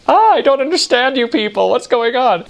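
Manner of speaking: high, squeaky mock confusion; hammed-up confused tourist; bewildered outburst. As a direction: throw up your hands in a silly high voice. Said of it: "I was just like".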